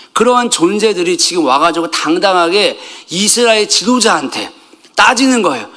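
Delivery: normal talking voice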